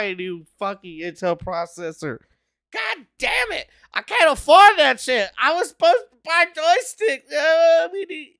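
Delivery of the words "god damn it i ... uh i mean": high, loud frustrated yelling with a crack of crying; furious outburst. Real, not acted. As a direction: rage at having to buy new hardware. Rant about buying new processor.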